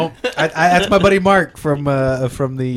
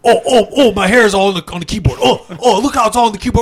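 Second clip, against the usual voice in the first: hammed-up frantic tech guy, higher and louder as he goes; ranting computer tech, building to shouts; Barry impression; 8 semitones higher, 5 dB louder than usual. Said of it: "Impersonation of Barry Robb".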